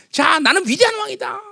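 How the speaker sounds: high, grandiose mock announcement; self-proclaiming king. Preacher imitates a boastful new king, high proclaiming voice. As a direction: declare your greatness to the crowd. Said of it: "이렇게 막 선전해야 될 텐데".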